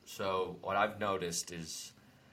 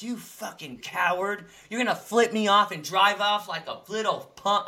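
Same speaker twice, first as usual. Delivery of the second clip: mock yell at a biker, higher and louder; retold angry yell; 10 semitones higher, 9 dB louder than usual. Recreating the road rage to a friend, a mock furious yell. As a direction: tell off the coward who flipped him off. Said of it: "I was gonna speed up and be like".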